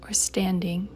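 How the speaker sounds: slow, measured meditation guide with long pauses; guided meditation. Acted. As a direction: guide them through it unhurriedly, leaving room to breathe.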